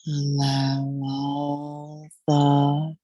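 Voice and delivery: slow, drawn-out meditation voice with long pauses; guided Yoga Nidra meditation. Real, not acted. Yoga guide leading listeners into deep rest, slow meditative delivery. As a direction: ease them into rest, stretching the words slowly.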